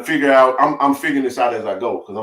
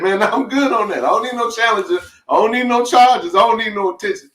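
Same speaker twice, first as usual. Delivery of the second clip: higher, laughing through it; laughing refusal; 9 semitones higher than usual. Real, not acted. To the rental agent offering sports cars: laughing no thanks. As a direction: shrug off the flashy cars with a laugh.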